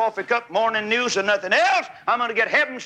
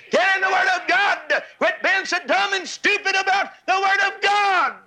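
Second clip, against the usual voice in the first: preaching at full force; 10 semitones higher than usual. Acted. Preacher rebukes the other preachers, high full-force shout.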